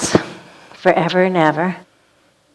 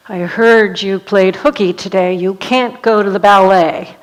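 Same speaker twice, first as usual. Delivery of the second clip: stern mother; 4 dB louder than usual. Retold or imitated. Mother scolding daughter at home.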